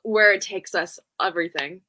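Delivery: ordinary talk, nothing marked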